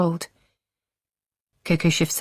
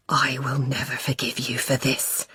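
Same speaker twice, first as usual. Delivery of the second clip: low, softer menacing growl; 3 semitones lower, 5 dB quieter than usual. A narrator's reading. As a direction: growl it low through the anger. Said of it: "he growls".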